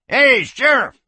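high, loud holler from a jail cell; hoarse yell. Acted. Jailed drunk calling to the sheriff, hoarse yell. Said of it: "yelled a hoarse voice".

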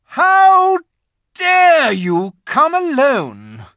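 very high, loud, slow witch; terrible witch voice. A narrator's reading. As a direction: rage at the boy as queen. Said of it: "said the witch in a terrible voice".

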